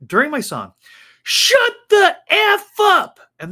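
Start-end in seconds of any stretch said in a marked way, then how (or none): 1.2-3.2 high, loud, slow mock heckler shout; stretched-out shouted put-down; imitating a yell. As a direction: belt it out like the rude stranger on the mic. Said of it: "they're yelling".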